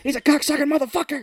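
loud, high swearing shout; hammed-up furious yell; mock enraged cursing. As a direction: blow up in a performed rant. Acting out enraged goading.

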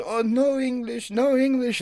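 high pitched imitation of grandma feigning no English; squeaky put-on broken English, playing dumb; mock Korean grandma. Grandson mocking his Korean grandmother, high put-on accent. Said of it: "she gets mad and goes".

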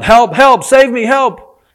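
high, loud desperate cries; panicked shouts for help; crying out for help. Acted. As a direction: yell for help as you dangle over a cliff. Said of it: "So he starts crying out".

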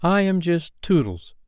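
lightly acted boyish dignity; dignified. A narrator's reading. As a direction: drop the silliness, state it grandly. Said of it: "spoke with dignity".

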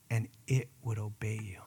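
low, whispered close of the quote; hushed whisper. Acted. Pastor quoting Jesus on the mulberry tree, whispered. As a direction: drop to a whisper.

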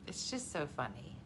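ordinary delivery

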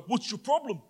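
high, confronting challenge; imitating a big mate stepping in; tough friend. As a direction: back him down. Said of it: "he walks up to the guy".

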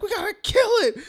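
high, loud whining voice; mock panic. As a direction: wail it like a scared kid. Panicked character quoted.

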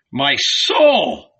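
loud, startled cry; Jesus recoils in horror; horrified exclamation. A narrator's reading. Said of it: "Jesus exclaimed".

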